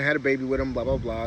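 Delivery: ordinary delivery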